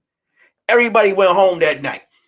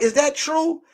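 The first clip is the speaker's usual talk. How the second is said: retold question; 8 semitones higher than usual. Acting out Teresa's question.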